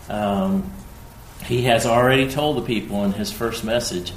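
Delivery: ordinary talk, nothing marked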